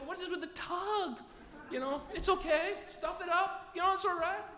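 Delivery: high-pitched, breezy acceptance; mock nonchalance. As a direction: wave it off in a squeaky comic voice. Brightly accepting the rectal thermometer.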